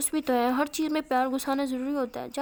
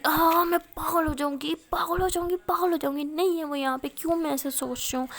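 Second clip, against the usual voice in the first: wailing yell of someone losing her mind; shouting; 4 semitones higher, 3 dB louder than usual. Acted. Aashi, hands on her ears, cries out she'll go mad, shouting. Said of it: "ज़ोर ज़ोर से चिल्ला रही होती है".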